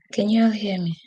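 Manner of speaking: normal talking voice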